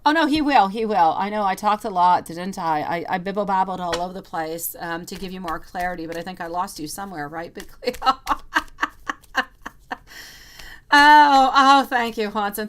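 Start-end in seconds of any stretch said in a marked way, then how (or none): 0.0-1.1 loud, high burst of delight; excited outburst. Real, not acted. Tarot reader exclaims to a viewer in chat, excited and loud. 8.0-9.7 bright burst of laughter; real laugh. Real, not acted. Tarot reader laughs at her own rambling, real laughter. 10.9-12.7 loud, high, gushing thank you; delighted thanks. Real, not acted. Tarot reader thanks a viewer for a gift, loud and delighted.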